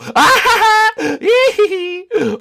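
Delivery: high shrieking burst of laughter; gleeful shriek of laughter; squealing laugh. Real, not acted. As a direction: let out a wild high laugh. Race start, high laugh.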